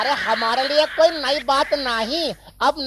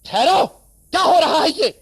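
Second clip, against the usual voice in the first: husband bursting in; 4 dB louder than usual. Acted. Husband bursts in catching them.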